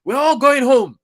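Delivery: loud, high-pitched crowd holler; retold uncle's shout. Retelling an uncle leaving the stadium, shouted high and loud. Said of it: "one uncle was saying".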